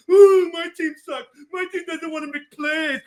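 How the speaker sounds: fake-cry it like a coach moaning on TV; mock crying whine. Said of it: "goes on T S N and goes".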